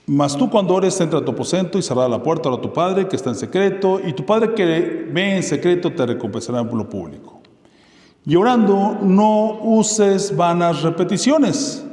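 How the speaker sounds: declaiming scripture from the pulpit; scripture reading. Acted. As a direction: proclaim the verse to the congregation. Reads scripture aloud, raised voice.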